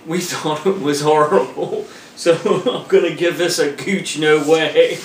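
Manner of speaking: words broken by chuckles; amused, laughing delivery; laughing while talking. Real, not acted. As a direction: chuckle through the verdict. Laughing while panning a movie.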